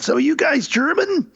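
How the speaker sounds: high, put-on voice of a curious cabbie; a lightly voiced taxi driver, pitched well up; taxi driver asking. Taxi driver quizzing drunk English tourists, high put-on voice. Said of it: "and he said".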